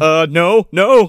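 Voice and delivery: loud, rising no, no; hammed-up loud protest; mock refusal. Cohost joining the duck's refusal of her, loud and rising. Cohost joins the recoil quote.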